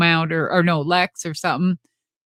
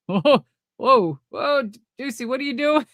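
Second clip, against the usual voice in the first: higher, startled mock protest; imitated nervous alarm; 6 semitones higher than usual. Sting reacting to an unplanned crawl, imitated nervous alarm. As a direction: imitate a partner caught off guard, pitched up. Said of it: "hear his nervous".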